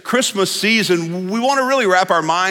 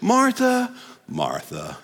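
soft, patient "Martha, Martha" with a laugh; calm her down lovingly; gentle Jesus; 4 semitones higher, 6 dB quieter, much slower than usual. Retold or imitated. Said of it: "Jesus responded this way".

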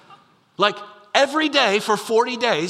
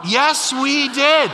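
much louder and higher, slow, each word punched; emphatic answer; 6 semitones higher, 5 dB louder, much slower than usual. Real, not acted. Preacher answering the crowd's imagined doubt, slow and emphatic. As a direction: land it slow and firm like a verdict. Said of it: "did we need to include that part".

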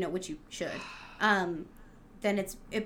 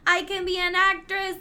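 acted excitement; 8 semitones higher, 6 dB louder than usual. Fan comparing herself to an actress, hammed-up excitement. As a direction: burst out in hammed-up, starstruck realization. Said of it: "I also am like".

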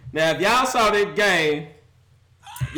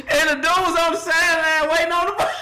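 higher, louder words through laughter; disbelieving laughing yell; laughing shout; 10 semitones higher, 3 dB louder than usual. Real, not acted. Mocking the Raiders' collapse.

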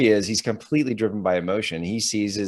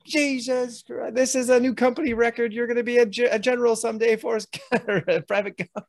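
very high, amazed sergeant voice; drill sergeant. Retold or imitated. Drill sergeant marvels at Gump's record, very high. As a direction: marvel at him like a stunned sergeant.

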